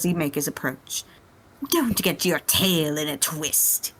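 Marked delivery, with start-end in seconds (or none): 1.7-3.9 a hint of a smug villain; chuckling Frieza. Frieza teasing the Saiyan, lightly voiced smug chuckle. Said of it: "he chuckles".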